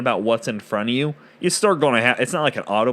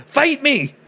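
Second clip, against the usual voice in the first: battle challenge shout; 4 dB louder, slower than usual. Acted. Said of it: "a group of guys and go".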